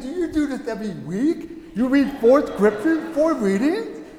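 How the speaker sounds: high-pitched, incredulous questioning; retold astonishment. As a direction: replay a newcomer's amazed disbelief. Amazed convert questions his wife.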